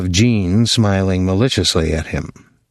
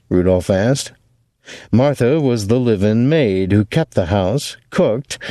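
usual speaking voice

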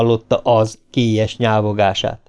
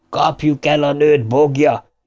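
pitched-up call to attack; mild yell, more pitch than volume; howled rallying cry; 4 semitones higher than usual. A narrator's reading. Ricsi rallies Ben against the monster, howling. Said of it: "üvöltötte Ricsi".

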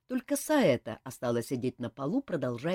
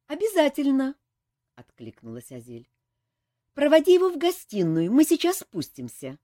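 brisk loud order; 10 semitones higher, 8 dB louder than usual. A narrator's reading. Azel answers the butler.

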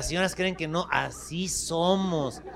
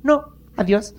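curt bird refusal; 5 semitones higher, 10 dB louder, slower than usual. Acted.

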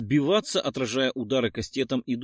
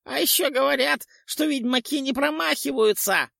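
gleeful ghoul yell; 10 semitones higher than usual. A narrator's reading. Ghoul gloats at the witcher, high squeaky glee. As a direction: crow over the miss like a nasty little ghoul. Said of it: "радостно завопил поганец".

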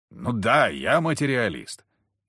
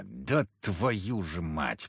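low, slow growled curse; 5 semitones lower, 7 dB quieter, much slower than usual. A narrator's reading. Hero curses his confusion, low slow growl. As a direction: growl it through the frustration. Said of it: "прорычал я".

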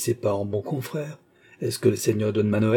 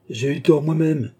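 piqued; 5 semitones higher, 3 dB louder than usual. A narrator's reading. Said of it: "dit-il dépité".

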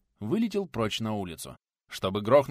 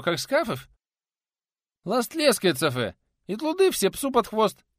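high, loud lisping peasant; toothless lisping craftsman; 9 semitones higher, 5 dB louder than usual. Acted. Nikodim arguing about drying bricks, high lisping exasperation.

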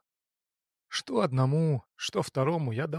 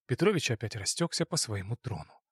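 usual speaking voice

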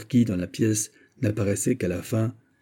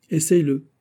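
commanding; 7 semitones higher, 4 dB louder than usual. Acted. Lord ordering the girl.

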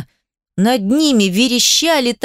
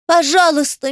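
tearful plea; 6 semitones higher than usual. Acted. Adel pleading in tears.

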